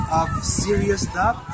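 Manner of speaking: ordinary delivery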